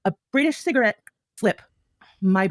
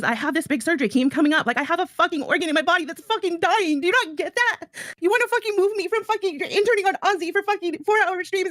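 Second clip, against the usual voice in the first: high, strained, desperate plea; pleading outburst; 6 semitones higher than usual. Retold or imitated. Streamer relives pleading over surgery, high and desperate. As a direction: beg for them to see she's dying. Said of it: "What I've said is".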